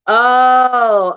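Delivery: loud, high, drawn-out "Oh"; sudden realisation. Real, not acted. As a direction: let the penny drop out loud. Suddenly understands the misread comment.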